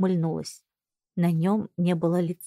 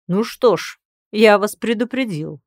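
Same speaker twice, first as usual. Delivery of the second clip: louder, menacing final warning; ominous warning; 2 semitones higher, 6 dB louder than usual. Acted. Lord's calm warning before violence. (зловещее предупреждение)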